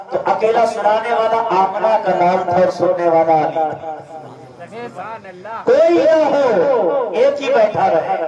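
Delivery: quick, rising sermon voice with a laugh; fervent preaching. Acted. Preacher rousing the gathering about love of the Prophet, fervent and laughing. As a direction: rouse the crowd with joyful conviction.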